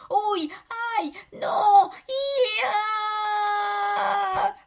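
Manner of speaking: high, loud death scream; yelp, then let out a long scream; acted agony, building to a drawn-out cry; screams of pain. A narrator's reading. Victim crushed against the wall.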